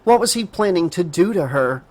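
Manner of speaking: ordinary delivery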